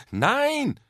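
high, loud shouted refusal; gerufen. A narrator's reading. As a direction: cry it out emphatically. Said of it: "riefen Mama und Otto wie aus einem Mund". (laut und hoch gerufen)

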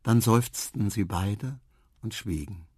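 usual speaking voice

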